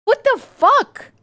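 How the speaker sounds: high, slow, stretched disbelief; high drawn-out what the fuck; incredulous. Real, not acted. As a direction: drag out the outrage, up high. Outraged rant.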